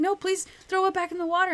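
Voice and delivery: high, loud, fast pleading; horrified plea. Retold or imitated. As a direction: beg in a rush, horrified. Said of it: "it horrifies her".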